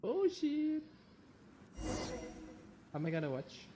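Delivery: ordinary delivery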